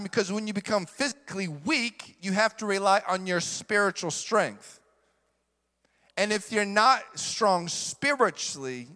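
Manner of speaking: ordinary talk, nothing marked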